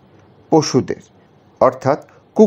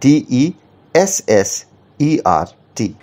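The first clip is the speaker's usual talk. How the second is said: slow spelling with double S; much slower than usual. Shown as a demonstration. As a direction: spell ডিইএসএসইআরটি slowly so the extra এস stands out. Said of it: "এটাকে তোমরা বলতে পারো dessert".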